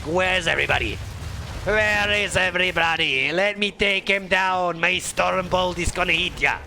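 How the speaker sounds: hammed-up dwarf warrior accent; bad Gimli accent. Commentator imitates Gimli on speed, bad dwarf accent. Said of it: "I'm horrible at accents".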